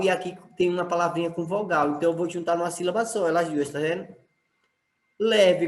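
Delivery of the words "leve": "Leve" with a strong, higher first syllable; stressed first syllable. Shown as a demonstration. Teacher finding the strong syllable, stress on the start of "Leve". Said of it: "qual é a sílaba sorte em leve".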